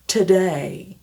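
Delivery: "Today" with the diphthong; model today. Shown as a demonstration. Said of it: "va apparaître dans day".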